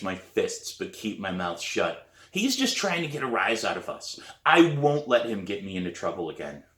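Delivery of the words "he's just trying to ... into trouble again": plain reading voice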